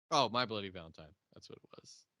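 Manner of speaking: usual speaking voice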